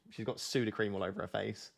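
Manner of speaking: usual speaking voice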